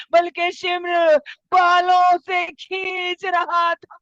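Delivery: sustained high wail, long endings; majlis lament. Acted. Preacher mourning orphaned Sakina before the gathering, wailing lament. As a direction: wail to bring mourners to tears.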